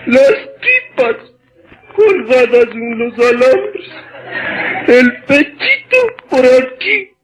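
high, slow hammed-up sobbing; mock wailing lament. Neighbor tells neighbor of husband's death, mock crying. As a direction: wail it like a grieving widow, overdone.

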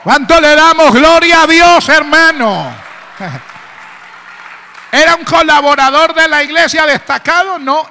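very loud, high, sustained exhortation; full-force preaching. Real, not acted. Preacher calling congregation to glorify God, full force. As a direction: shout it out to the whole church.

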